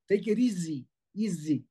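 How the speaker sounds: EASY with a buzzing voiced z; correct English easy. Shown as a demonstration. Teacher showing the right English easy, voiced z. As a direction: say "easy" as "ee-zee". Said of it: "no sabe pronunciar la palabra en inglés".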